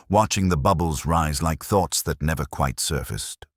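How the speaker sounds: ordinary delivery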